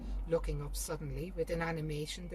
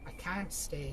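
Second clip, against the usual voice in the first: usual speaking voice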